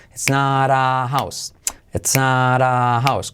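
slow, clear model sentence with "not" tapped like "nar"; slow model repetition. Shown as a demonstration. Teacher repeats slowly for Spanish learners, slow and clear. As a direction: say it slowly for the class to copy.

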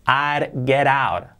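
ordinary talk, nothing marked

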